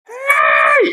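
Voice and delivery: piercingly high long hey; mock yell. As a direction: imitate her shrieked greeting to a Filipino. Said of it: "'cause she yelled".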